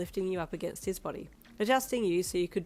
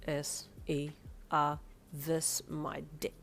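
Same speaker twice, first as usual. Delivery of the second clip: slow smug spelling; 2 semitones lower, 3 dB quieter, much slower than usual. Acted. Crude spelling comeback.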